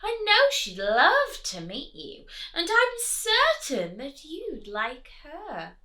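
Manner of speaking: high, coaxing duck voice; sweet-talking duck. Acted. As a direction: lure him in with a sweet high voice. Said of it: "cried the duck".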